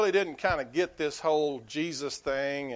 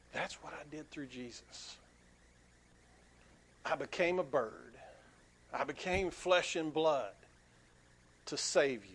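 gentle voice of God; 5 dB quieter than usual. Retold or imitated. God speaking to the man.

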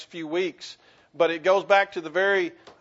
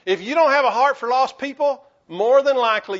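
higher and louder, pressing on "don't"; a louder, higher push of conviction; preaching loud; 7 semitones higher, 7 dB louder than usual. Real, not acted. Preacher confronting congregation.

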